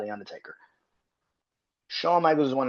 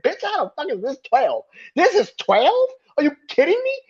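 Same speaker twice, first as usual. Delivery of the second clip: squealing high, loud disbelief; hammed-up fury climbing higher; shrieking mock indignation at a ranking; mock outraged rant; 6 dB louder than usual. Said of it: "I got so upset".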